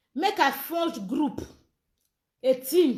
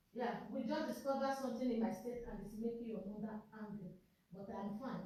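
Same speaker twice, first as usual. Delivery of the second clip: very soft, lower off-mic explanation; quiet aside; 4 semitones lower, 18 dB quieter than usual. Real, not acted.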